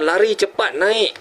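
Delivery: high-pitched urgent warning. Retold or imitated. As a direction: call them to get out, pitched high. Urges drowning rescuers to flee.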